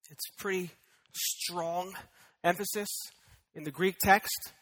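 usual speaking voice

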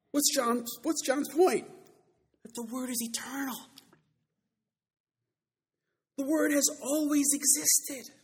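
high, forceful pulpit declaration; emphatic preaching. Acted. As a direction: hammer out the conclusion with long pauses. Preacher presses the conclusion.

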